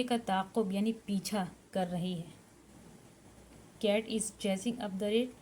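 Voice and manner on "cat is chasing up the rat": very slow, word by word for students to write; slow, careful dictation pace. Shown as a demonstration. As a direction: spell it out slowly for the class to copy. Teacher dictating English sentence.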